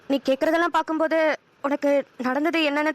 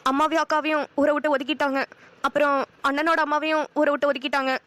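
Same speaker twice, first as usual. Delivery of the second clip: normal talking voice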